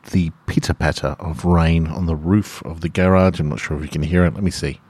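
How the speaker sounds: ordinary delivery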